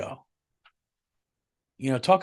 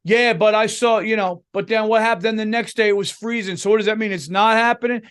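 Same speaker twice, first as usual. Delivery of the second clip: mock weather skeptic; 7 semitones higher, 4 dB louder than usual. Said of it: "Guy who says".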